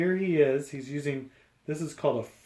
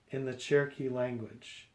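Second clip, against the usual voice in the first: plain reading voice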